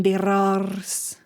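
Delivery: R's with trilled r, drawn out slowly; rolled r. Shown as a demonstration.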